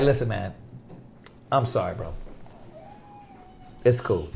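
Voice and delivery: hushed, low-pitched calm apology; calm retold apology. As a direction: drop right down, easing someone off quietly. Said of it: "I'm just calm as hell saying this".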